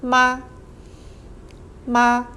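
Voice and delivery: 妈 said as ma, neutral light tone; 妈 repeated as short ma; model Chinese word. Shown as a demonstration. Teacher modeling the question particle. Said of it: "pronounced as".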